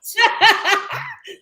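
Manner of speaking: high burst of laughter; big real laugh; loud laugh. Real, not acted.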